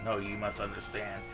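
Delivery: usual speaking voice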